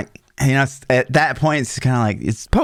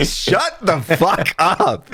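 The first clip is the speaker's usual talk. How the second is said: slow, drawn-out angry yell; slow mock yell at the girlfriend; retold angry yell; 7 semitones higher, much slower than usual. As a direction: spit each word out, fed up. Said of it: "the most loud I've ever".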